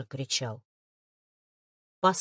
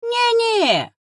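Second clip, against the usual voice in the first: very high, loud creature denial; hasty reassurance; 14 semitones higher, 5 dB louder than usual. A narrator's reading. Sian hurries to reassure his worried partner, high and hasty. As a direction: rush to deny it in a squeaky-high voice. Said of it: "поспешил заверить Сиян".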